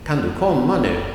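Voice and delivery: quick "kan du", emphasized "komma", light "nu"; stress on komma. Shown as a demonstration. Teacher giving an example question, stressing main verb "komma". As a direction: run through "kan du" and lean on "komma". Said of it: "that is emphasized because that's the main verb".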